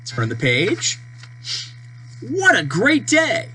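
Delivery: usual speaking voice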